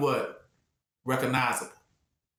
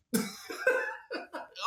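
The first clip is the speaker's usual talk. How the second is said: squealing laugh of joy; high delighted laugh; 5 dB quieter than usual. Real, not acted. Preacher laughing at the joy of being found, high laugh.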